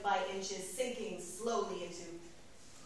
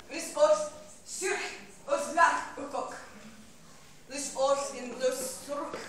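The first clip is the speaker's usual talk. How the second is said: higher, punched-out alien phrases; invented-tongue declamation; 4 semitones higher, slower than usual. Acted. Rose Lalonde warning in a strange tongue, declaimed. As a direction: deliver the strange tongue in forceful bursts.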